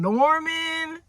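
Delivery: high, drawn-out name in disbelief; incredulous exclamation. Acted. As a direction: wail the name, can't believe it. Incredulous repeat of lover's name.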